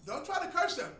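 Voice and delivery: high, loud quoted command; God's warning. Retold or imitated. Preacher voices God's warning not to curse, loud and high.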